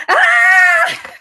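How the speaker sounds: very high, loud scream of fright; panicked scream. Retold or imitated. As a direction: shriek back at the flapping bird. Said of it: "And I'm like".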